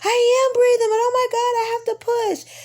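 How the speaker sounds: high, loud pained cry; distressed wail. Retold or imitated. Mother in labor crying to doctor that she must push, high pained wail. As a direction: cry out in agony.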